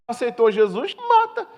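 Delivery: high, mocking squeal on mata; mock outrage. Preacher mocks those who condemn new converts, mock squeal. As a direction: parody a harsh judgement, squeaking the last word.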